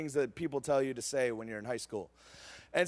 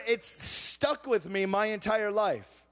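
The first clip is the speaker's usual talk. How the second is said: ordinary talk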